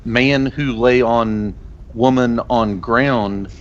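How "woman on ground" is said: slow solemn saying. Acted. Slow in-character goat proverb.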